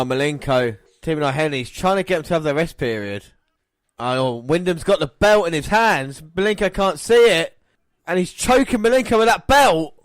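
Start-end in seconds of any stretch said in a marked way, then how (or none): 4.0-9.9 fast, rising commentator call; excited play-by-play. Acted. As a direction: call the action live.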